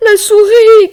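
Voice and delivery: very high, loud cry; bear's cry. Acted. Frightened bear crying out to wake the mouse, very high and loud. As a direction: shout her name. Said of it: "cria-t-il".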